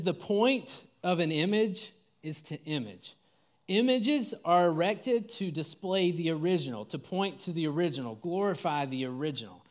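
deliberate, emphatic quote reading; measured reading with weight on key words; slow reading. Real, not acted. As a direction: read it out slowly, phrase by phrase. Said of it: "I'm gonna read it slow".

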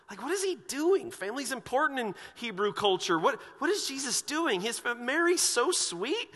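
high, loud mock disbelief; incredulous onlookers. Baffled onlookers defending Mary to each other, high-pitched incredulity. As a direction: protest in a rising, scandalized squeal.

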